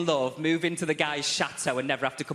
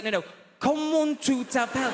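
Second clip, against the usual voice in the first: professor shouting the question, much higher and louder; exasperated shout; 10 semitones higher, 3 dB louder than usual. Retold or imitated. Professor losing patience with the student, shouting. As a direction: bellow the question at the student who won't get it. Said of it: "He's like".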